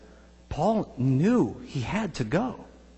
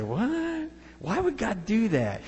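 indignant surprise; 6 semitones higher, 5 dB quieter, slower than usual. Retold or imitated. Imagined listener objecting to God.

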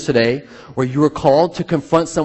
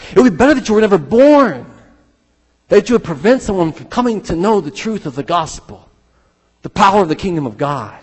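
thundering warning; 9 semitones higher, 8 dB louder than usual. Retold or imitated. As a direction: proclaim it like a prophet's woe. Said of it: "Jesus himself said".

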